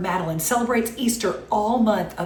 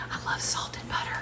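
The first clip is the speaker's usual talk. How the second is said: whispered aside; 12 dB quieter than usual. Real, not acted. Host confiding her love of salted butter to viewers, whispered. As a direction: whisper it, much softer, like a secret.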